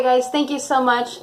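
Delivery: usual speaking voice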